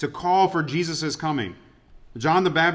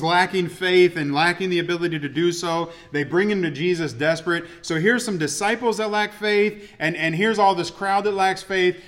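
normal talking voice

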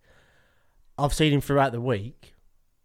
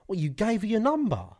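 raised pitch, mock disbelief; retold disbelief; 9 semitones higher than usual. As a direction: rzucone z niedowierzaniem, wyżej. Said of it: "and I was like".

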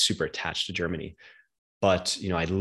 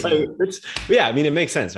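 laughing along; 9 semitones higher, 6 dB louder than usual. Real, not acted. Both laughing about shared career path.

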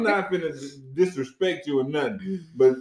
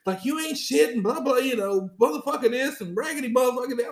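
mock ranting woman; 8 semitones higher than usual. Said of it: "She is going".

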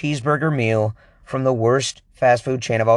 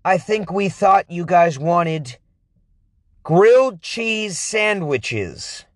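clueless worker; 6 semitones higher than usual. Retold or imitated. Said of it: "the person's like".

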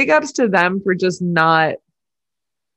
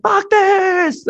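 much higher angry outburst; imitating Max snapping; 14 semitones higher than usual. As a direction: blow up at the team boss. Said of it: "And he's like".

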